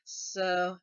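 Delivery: ordinary talk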